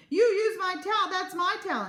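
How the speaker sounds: high, loud accusation; petulant, loud complaint; indignant squabbling. A narrator's reading. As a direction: snap it out like a quarrelling child. Parents squabbling childishly over a towel.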